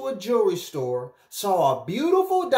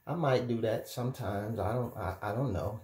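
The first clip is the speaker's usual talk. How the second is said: low, hushed reluctant admission; sheepish confession; 8 semitones lower, 8 dB quieter than usual. Acted. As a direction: mumble it softly, like owning up reluctantly. Voicing a sheepish guilty viewer confessing.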